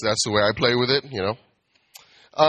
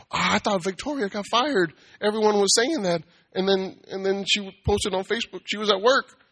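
higher gossipy coworker voice; gossiping coworker; 8 semitones higher than usual. Retold or imitated. Coworker gossiping about Victoria, pitched up and chatty. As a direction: gush the rumour, pitched up. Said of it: "bartenders was like".

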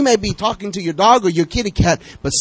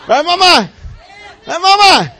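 calling out; 12 semitones higher, 8 dB louder than usual. Real, not acted. Calling out to a mother.

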